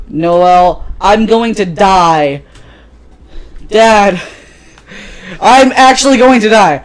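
loud, rising, hammed-up dad; melodramatic dying plea. Dad guilt-tripping his daughter, melodramatic loud plea. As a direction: build from a drawn-out plea to a high loud cry.

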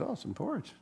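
put-on a light girlish coo at the porridge; hint of a little girl finding porridge; Goldilocks voice. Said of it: "She goes".

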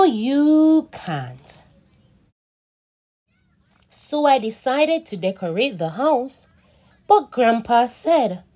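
put-on slow, expressive read-aloud for kids; reading to children. A narrator's reading. Teacher reading a story to children, animated and slow. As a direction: read it like a picture book for little ones. Reads picture book aloud.

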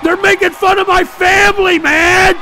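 high, loud indignant yell; outraged shout. Real, not acted. Streamer yelling at chat for mocking his family, outraged. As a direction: cry out that it's unfair.

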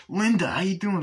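high, eager mock hello; lightly voiced eager Arnie; eager greeting.

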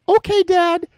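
mock kid reply. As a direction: chirp it like an obedient kid. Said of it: "Just like".

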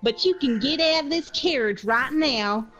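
indignant in-character ultimatum, opening stretched out; mock-affronted customer standing firm; haughty refusal. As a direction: refuse grandly, then order them out.

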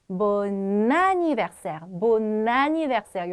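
Bon anniversaire with a long n linking the words; with liaison. Shown as a demonstration. Teacher shows students the linked form, slow liaison. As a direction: run bon into anniversaire: bon-nanniversaire. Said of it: "연음해 주었죠".

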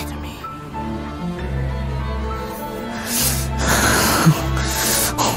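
long trembling grieving sigh; shaky sigh. Acted. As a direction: let out a breathy tearful sigh. Grieving over ashes.

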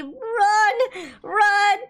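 sudden high panicked cry; panicked yell. Real, not acted. Fleeing the teddy bear.

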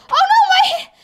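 high crying wail; distressed wail. Acted. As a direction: wail in dismay. Character's hair full of sand.

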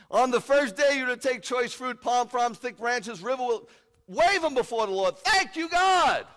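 high, full-force shouted proclamation; exultant yell, building to a peak; jubilant shout. Acted. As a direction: shout the verse out in celebration. Same verse belted out in celebration.

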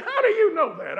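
loud, high mock-indignant comeback; high-pitched, amused mock outrage; indignant retort. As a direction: imitate an old lady firing back, loudly. Acted teasing exchange with Miss Ella.

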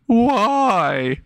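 high-pitched drawn-out wail; hammed-up tearful whine; mock crying. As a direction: cry it out slowly, way up high. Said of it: "crying, saying".

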